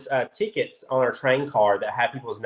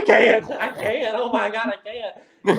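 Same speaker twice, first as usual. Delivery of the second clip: higher, slow, laughing fan overwhelm; retold star-struck panic, pitched up and laughing; starstruck; 8 semitones higher than usual. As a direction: gush like a fan who can't cope. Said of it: "I'm like".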